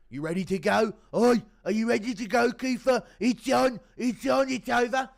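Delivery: loud, high mock referee bellowing at the fighter; hammed-up shouting ref. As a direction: yell it like an impatient ref hurrying a dazed fighter. Mocking referee rushing fighter back.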